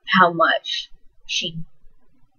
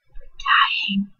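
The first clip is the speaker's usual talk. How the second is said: whispered; 3 dB quieter than usual. Retold or imitated. Dying mother's whispered words.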